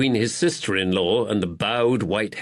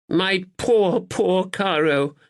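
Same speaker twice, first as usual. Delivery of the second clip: fussing tenderly; 9 semitones higher, slower than usual. Acted. Duddles fussing over Caroline.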